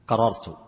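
قررت with an a after the first ra; fatha on the ra. Shown as a demonstration. Sheikh answering a student on the past tense, qarartu with fatha. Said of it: "بالفتح".

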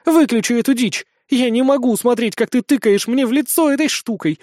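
high, flustered demand; outraged protest. A narrator's reading. Witch protesting the boy's projected fantasy, outraged. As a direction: insist he switch it off, scandalized. Witch orders images switched off.

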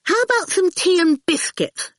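high-pitched bright hostess voice. A narrator's reading. Miss Potter offering her callers tea, bright. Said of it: "Miss Potter said brightly".

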